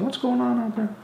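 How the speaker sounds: puzzled dad. Retold or imitated. Dad puzzled by kids painting the fence, calling out. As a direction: call out like a confused dad. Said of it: "Dad's going".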